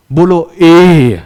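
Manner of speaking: teacher leads a chant, slowly and loud; drawn-out call. Shown as a demonstration. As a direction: stretch out ए so pupils repeat. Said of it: "मेरे साथ बोलो तो ए".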